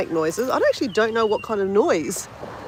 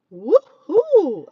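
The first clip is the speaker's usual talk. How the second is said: happy cheer; 6 semitones higher, 7 dB louder than usual. Real, not acted. Arrives at the beach cheering.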